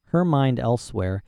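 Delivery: ordinary delivery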